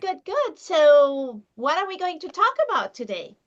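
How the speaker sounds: ordinary delivery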